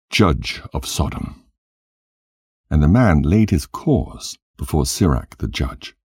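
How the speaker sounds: ordinary delivery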